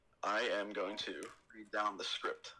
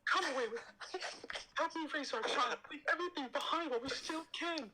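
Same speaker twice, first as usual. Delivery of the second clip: high breathy falsetto Padme, swooning; hammed-up high Padme voice. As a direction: squeal her name in a high falsetto. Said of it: "my Padme is unmatched".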